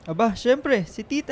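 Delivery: loud, high, proud teasing exclamation; playful eager boast. Acted. Boyfriend claiming he will hang her medals, loud excited boast. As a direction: announce it like the obvious answer, grinning.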